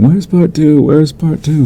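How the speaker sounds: high-pitched mock fan pleading; clamoring fans. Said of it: "everyone was asking".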